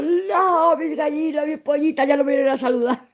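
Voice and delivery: high, whiny pretend lament; imagined self sobbing over the chickens; mock crying. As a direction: wail over lost hens, hammed up. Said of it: "Imagínate yo llorando".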